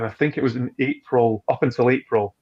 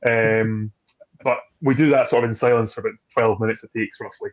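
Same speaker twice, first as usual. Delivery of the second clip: usual speaking voice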